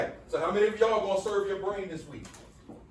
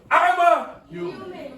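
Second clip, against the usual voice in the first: sassy, higher and louder call; with attitude; 9 semitones higher, 5 dB louder, slower than usual. Shown as a demonstration. Teacher shows the class how to say it with attitude. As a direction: throw some attitude into it. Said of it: "Come on, say it with an attitude".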